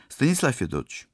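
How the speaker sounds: put-on high, wheedling girl's plea; pleading. A narrator's reading. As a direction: beg the captain for permission. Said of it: "взмолилась она".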